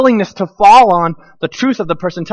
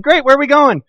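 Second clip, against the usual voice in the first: higher, louder eager question; eager kids; 8 semitones higher, 5 dB louder than usual. Retold or imitated. Kids asking where they're going, eager and high. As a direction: kids keen to know the destination. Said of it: "And they're like".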